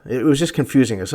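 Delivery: usual speaking voice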